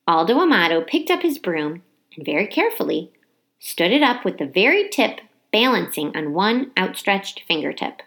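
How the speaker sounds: plain reading voice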